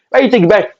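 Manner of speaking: imitating big sister's rising telling-off; sister scolding.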